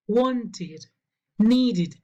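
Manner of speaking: "Wanted" and "needed" with a full second syllable "id". Shown as a demonstration. Teacher models the exception, "Wanted, needed" ending in "id". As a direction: say "Wanted, needed" as "want-id, need-id". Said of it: "se pronuncia id".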